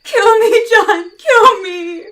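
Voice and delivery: desperate shouted plea, crying; terrified crying plea. Acted. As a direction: beg him through tears at full voice. Terrified woman begs for death.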